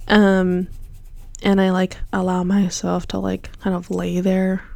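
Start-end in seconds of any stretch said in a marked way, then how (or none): none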